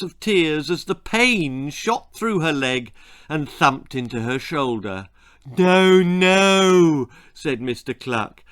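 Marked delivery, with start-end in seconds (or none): 5.6-7.1 high, loud, drawn-out "no, no"; long, sing-out fatherly denial; dismissive father. Acted. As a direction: wave the crying away in a raised voice. Father hushing crying baby.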